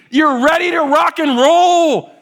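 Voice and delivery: high, loud, drawn-out rallying cry; hyped-up shout. Acted. Preacher playing someone raring to go, hyped-up shout. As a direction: belt it out slowly, stretching the last word. Acting out eager readiness.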